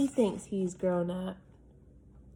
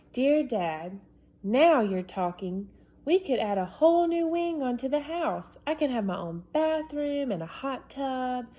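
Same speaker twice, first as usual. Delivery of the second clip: higher, louder, bubbling with big plans; excited boy; 4 semitones higher, 4 dB louder than usual. Acted. Boy pitching his dream house to dad, excited. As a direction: pile on the wishes excitedly. Reads Alex's letter aloud.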